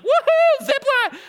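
very high, loud woohoo; whooping cheer. Retold or imitated. Wife thrilled at the zipline, very high whooping cheer. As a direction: squeal it with glee. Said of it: "she's like".

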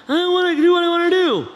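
high, loud, fast whine; sulky kid. Acted. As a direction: blurt it like a petulant kid. Kid sulking.

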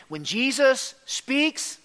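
drawn-out setup rising on "speaks"; rhetorical build. Real, not acted. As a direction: lift "speaks" and leave it hanging. Preacher's contrasting refrain.

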